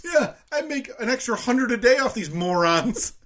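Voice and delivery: scheming clerk voice. Pretend. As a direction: gloat like a petty thief.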